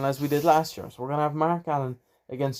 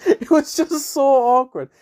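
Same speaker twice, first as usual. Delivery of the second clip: high, loud, drawn-out laughing delivery; 11 semitones higher, 7 dB louder, slower than usual. Real, not acted. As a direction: crack up while recalling an awkward moment. Laughing at his awkward anecdote.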